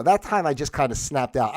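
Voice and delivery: normal talking voice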